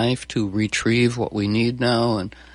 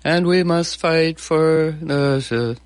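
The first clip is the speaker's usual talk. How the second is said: slow, higher, fading politician cadence; mock Trump speech; 6 semitones higher, much slower than usual. Mocking Trump's speech to the host, slow and higher. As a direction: drag it out like a rally speech trailing off. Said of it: "like when he goes down at the end of every sentence".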